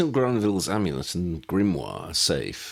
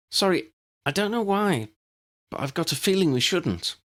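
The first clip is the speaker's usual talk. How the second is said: put-on girl's apology; 12 semitones higher than usual. A narrator's reading. Lydia voiced by narrator.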